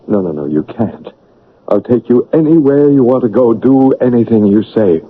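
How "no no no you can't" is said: soft pleading. Acted. Rochester pleading.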